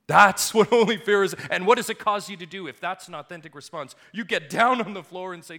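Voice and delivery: loud, high preaching build; thundering pulpit voice; fiery preaching. Acted. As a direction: preach it with rising fire. Preaching at full force.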